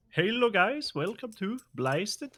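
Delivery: high, slow mock Dutch accent. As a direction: put on a hammy Dutch accent. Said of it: "an attempt at a Dutch accent".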